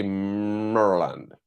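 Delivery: "Maryland" opening like Murray, not Mary; Murray-style Maryland. Shown as a demonstration. Streamer attempts a viewer's state, "Maryland" said with Murray in it. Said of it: "trying to say Murray instead of Mary".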